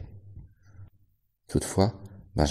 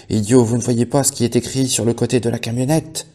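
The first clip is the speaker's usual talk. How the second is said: angry shout; 5 semitones higher, 6 dB louder than usual. A narrator's reading. Benjamin yelling at animals.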